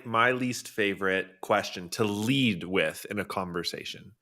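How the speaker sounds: ordinary talk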